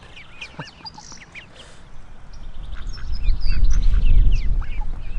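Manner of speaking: big wheezing cackle, loud; loud wheezy cackle at his own joke; loud laugh. Real, not acted. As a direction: burst out laughing hard.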